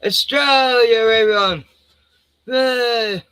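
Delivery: loud, high, drawn-out cheer; big playful flag-waving holler; patriotic cheer. Pretend. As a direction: whoop it up for Australia.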